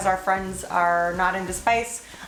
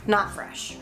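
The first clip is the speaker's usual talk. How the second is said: ordinary delivery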